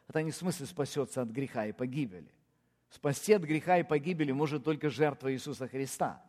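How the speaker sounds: ordinary talk, nothing marked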